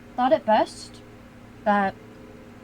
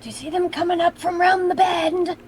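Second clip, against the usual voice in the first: whisper yelling; 5 semitones higher than usual. Acted. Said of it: "Because I'm whisper yelling".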